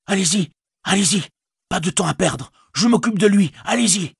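high, hurried, urging voice; urgent Sam. Acted. Sam urges Frodo on in a high, hurried voice. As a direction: push the master on, no time to lose.

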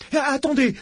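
high-pitched, panicky cry. A narrator's reading. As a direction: call out urgently to stop them. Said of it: "s'écria Harrod". (cri aigu et affolé)

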